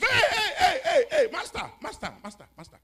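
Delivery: excited pleading. Retold or imitated. Excited man begs on phone.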